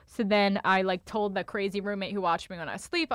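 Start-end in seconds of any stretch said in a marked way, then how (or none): none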